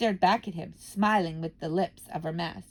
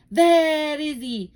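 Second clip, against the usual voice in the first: slow, loud, sharp snap; witch snapping; 7 semitones higher, 9 dB louder, much slower than usual. Acted. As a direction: snap it at the room. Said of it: "snapped the Grand High Witch".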